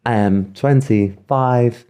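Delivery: slow, even sat nav announcer; gps voice. Shown as a demonstration. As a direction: read it out like a car's sat nav. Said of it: "I can do that voice really well".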